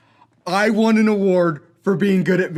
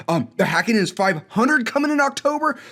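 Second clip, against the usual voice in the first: ordinary talk, nothing marked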